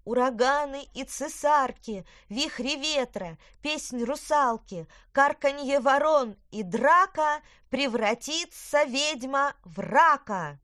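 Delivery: high, loud, slow witch incantation; cackling witch yelling a spell; witch shouting spell. A narrator's reading. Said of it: "ведьма прокричала своё заклинание".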